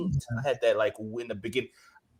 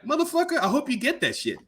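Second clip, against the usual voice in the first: mock angry; 9 semitones higher than usual. Angry retort to hoax claim.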